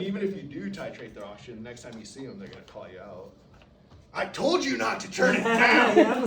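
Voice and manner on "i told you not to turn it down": loud, high, drawn-out mock scolding; indignant patient outburst. Patient catching staff changing the oxygen, indignant yell. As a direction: holler it like an outraged patient.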